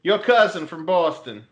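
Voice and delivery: a hint of Boston tough guy telling a story; Boston accent. Teasing cohost with a Boston accent story. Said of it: "once you get into that Boston accent".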